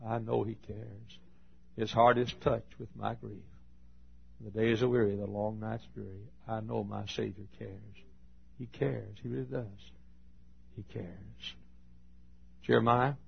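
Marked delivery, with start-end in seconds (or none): 0.1-11.6 tender reassurance. Real, not acted. Preacher comforting the lonely in the pews, soft and tender. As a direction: comfort the lonely listener gently.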